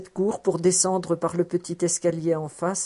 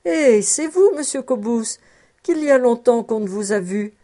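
high, lightly raised neighbourly hail; calling out; 4 semitones higher, 4 dB louder than usual. A narrator's reading. Gossips at their doors hail the returning Cobus, mildly calling out. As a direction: hail someone across the street, not at full shout. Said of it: "lui criant".

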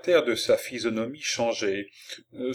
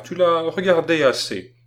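louder, higher-voiced insistence; ordering; 7 semitones higher, 6 dB louder than usual. A narrator's reading. Grandfather calling the girl away from the corpse, ordering. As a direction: put your foot down with a child. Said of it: "ordonna le vieillard".

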